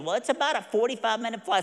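put-on high, soft old-man voice; elderly man. Elderly pilot quoting flight time, high old-man voice. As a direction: play the aged pilot, high and small. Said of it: "this elderly gentleman answers the phone".

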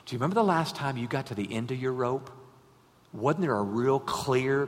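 usual speaking voice

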